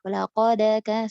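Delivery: laqad kana with a fathah vowel added, lakoda; wrong laqad kana. Shown as a demonstration. Teacher demonstrating the wrong laqad kana. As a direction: add a vowel after the q in laqad.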